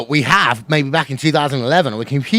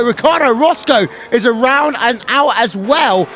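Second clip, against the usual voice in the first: excited race commentary, pitch climbing for the win; live play-by-play; 5 semitones higher than usual. Acted. Race announcer to viewers on the final lap, excited play-by-play.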